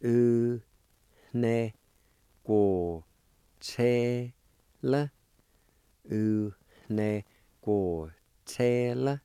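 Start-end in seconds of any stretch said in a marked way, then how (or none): 0.0-5.1 sounded out one by one; U, ne, go, che, la, each syllable set apart; syllables of U, ne, go, che, la said with pauses between them; Cherokee syllables. Shown as a demonstration. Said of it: "It's pronounced like this".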